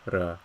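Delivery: "ra" with a rolled trilled r. Shown as a demonstration. Teacher demonstrates northern trilled r in ra. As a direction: roll the r in "ra". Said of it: "trilled R".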